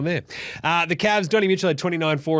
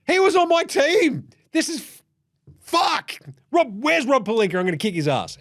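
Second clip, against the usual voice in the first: hammed-up fury; mock LeBron fuming; mock outrage; 11 semitones higher than usual.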